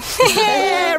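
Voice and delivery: high gleeful character laugh; gingerbread man's laugh. A narrator's reading. Gingerbread man laughing at the cow, high laugh. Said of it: "laughed at the cow".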